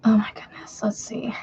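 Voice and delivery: whispered exclamation; whispering. Real, not acted. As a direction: breathe it out softly. Whispering to herself.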